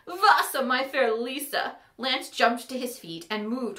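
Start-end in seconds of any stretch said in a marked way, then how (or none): 0.1-1.8 adoring cry. A narrator's reading. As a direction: call out ardently to your beloved. Smitten Lance greets his beloved.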